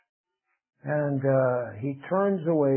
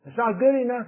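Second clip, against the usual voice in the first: mock indignant protest; 6 semitones higher than usual. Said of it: "They get angry".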